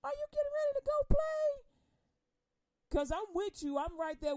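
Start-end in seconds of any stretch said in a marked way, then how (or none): 0.0-1.6 much higher, faster, fussy sing-song; a nagging parent checking in; high quick mock-nagging question. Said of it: "I don't ever ask you".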